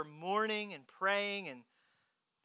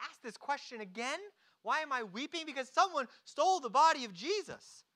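higher-pitched, incredulous acted protest; exasperated retort; 6 semitones higher than usual. Mary is asked again why she weeps, exasperated.

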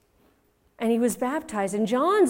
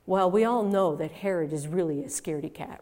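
normal talking voice

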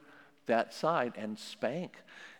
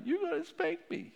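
put-on much higher little child voice; child's voice. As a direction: answer like a small kid caught out. Child answering parent in role-play.

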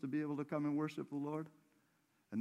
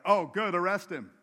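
loud, higher-pitched acted crowd reply; mock an eager crowd, raised and loud; eager mob; 5 semitones higher, 9 dB louder than usual. Preacher voices the crowd come to arrest Jesus, mock eager.

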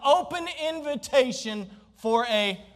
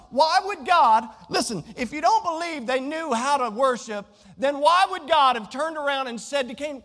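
ordinary talk, nothing marked

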